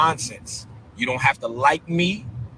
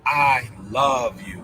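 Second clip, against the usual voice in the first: slow, low, drawn-out "I love you"; heartfelt, lingering declaration; passionate; 5 semitones lower, much slower than usual. Shown as a demonstration. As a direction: stretch out "love" with deep passion. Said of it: "the last one was more passionate".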